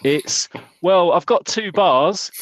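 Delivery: hammed-up bad-signal moan; mock caller. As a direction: play a fed-up caller griping about signal. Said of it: "on the phone to B T".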